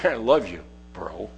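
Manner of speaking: tossed-off I love you bro, dropping away to nothing; offhand mumble. Shown as a demonstration. Preacher showing a careless, empty I love you. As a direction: throw it away casually, trailing off. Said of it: "Or you could say it like this".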